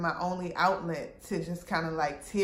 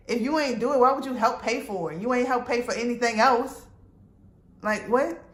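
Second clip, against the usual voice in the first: higher and louder, heated mock confrontation; retold angry comeback; 5 semitones higher, 6 dB louder than usual. Telling the guy who slashed her tires off, heated retold comeback. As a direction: throw it back at him, fed up.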